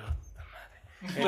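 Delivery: slow, breathy whisper of dismay; whispered curse. Retold or imitated. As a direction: whisper it in despair. Reaction to hearing bad news.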